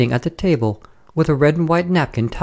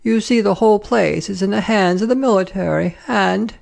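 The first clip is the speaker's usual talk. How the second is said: higher-pitched fussy French madame; officious lady traveller; 7 semitones higher than usual. Acted. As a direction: voice a gossipy lady on a train. Voices the lady opposite.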